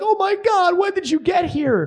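hammed-up tearful relief; frantic parents. Frantic parents voiced in story.

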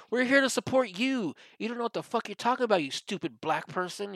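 high, loud indignant mock yell; imitated yelling. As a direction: yell it like a self-righteous activist scolding. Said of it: "you have Antifa yelling at them".

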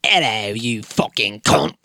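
a rude British judge, barely disguised; Simon Cowell impression. Cohost does a mocking Simon Cowell, lightly British accented. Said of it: "here's my Simon Cowell impression".

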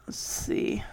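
whispered to himself while looking; whispering. Real, not acted. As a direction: breathe it under your breath. Muttering to self while searching.

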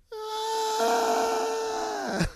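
a very high, breathy little ah; stunned gasp. Retold or imitated. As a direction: caught completely off guard. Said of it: "And I was like".

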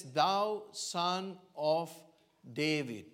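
Thou son of David stretched very slowly, Thou leaned on; drawn-out cry. Acted. Preacher voicing the blind beggar calling Jesus, drawn out slowly. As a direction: draw each word out like a beggar calling. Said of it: "He says".

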